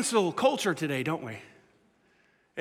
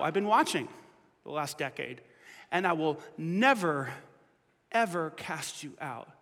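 gentle reassurance; 5 semitones lower, 7 dB quieter than usual. Retold or imitated. Jesus answering the sinner, softly reassuring. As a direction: fade to a hush on out.

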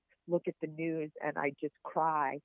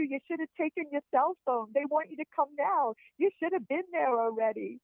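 higher-pitched urgent mock scolding; 10 semitones higher than usual. Retelling a call to come in for surgery, urgent. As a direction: hurry them along in a high, insistent voice.

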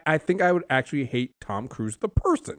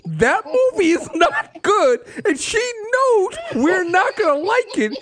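laughing; 12 semitones higher than usual. Real, not acted. Laughing while making her point.

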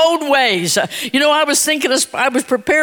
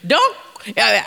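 usual speaking voice

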